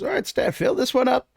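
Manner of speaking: pleased with his work, hand it over; cheerful shopkeeper. Acted. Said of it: "He goes".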